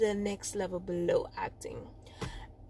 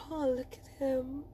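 softer, pretend swooning; mock smitten; 8 dB quieter than usual. Acting the moods of love songs, lightly smitten. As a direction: swoon lightly over a crush. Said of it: "Whether you're in".

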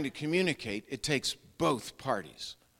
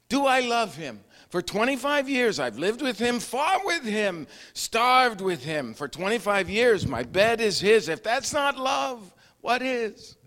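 higher, emphatic wife's voice; Golde reflecting; 7 semitones higher than usual. Retold or imitated. Golde to Tevye, emphatic mildly voiced wife. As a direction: lay out the years with feeling. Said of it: "Golde's reply, retold".

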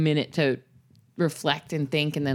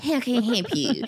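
higher; mock phone greeting; 5 semitones higher than usual.

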